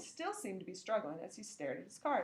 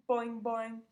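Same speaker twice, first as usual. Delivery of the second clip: frog boings; 5 dB louder than usual. Acted. Frog Og answering.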